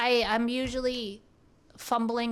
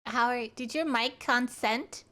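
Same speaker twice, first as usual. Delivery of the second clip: normal talking voice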